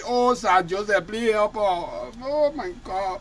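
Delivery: slow, hushed plea; pleading prayer. Real, not acted. Host praying to Sir Joseph, slow hushed plea. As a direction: beg for help, slowly and softly.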